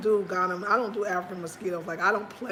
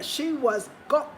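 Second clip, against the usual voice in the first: usual speaking voice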